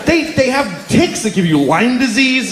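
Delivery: ordinary talk, nothing marked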